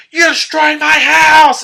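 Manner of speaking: high, loud, slow yell at him; furious shout. Retold or imitated. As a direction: scream it at the man who wrecked her home. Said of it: "She's like".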